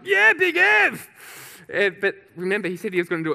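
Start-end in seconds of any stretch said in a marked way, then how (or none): 0.0-1.1 high, loud, drawn-out victory yell; erupting cheer. Retold or imitated. Whole station celebrates a new record, erupting high shout. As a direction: erupt in a long roaring cheer. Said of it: "So we're all erupting".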